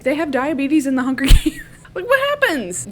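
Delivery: high, incredulous laughing question; talking through real laughter. Real, not acted. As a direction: crack up while asking, pitch shooting up. Laughing at absurd plot gap.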